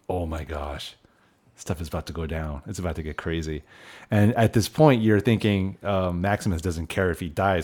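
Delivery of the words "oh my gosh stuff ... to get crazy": soft, low retold audience murmur; hushed awe. Host voicing the theater crowd before a showdown, hushed awe. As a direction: drop your voice in imitated anticipation.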